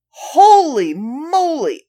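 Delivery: slow, drawn-out holy moly; surprised exclamation. Real, not acted. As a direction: marvel at it out loud. Reacts to the next fact.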